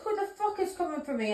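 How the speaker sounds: high-pitched, indignant retold challenge; retold shout. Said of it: "I was shouting that".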